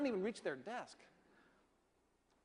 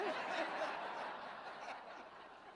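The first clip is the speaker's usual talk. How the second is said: breathy laugh; 12 semitones higher, 6 dB quieter than usual. Real, not acted. Laugh after brother's joke.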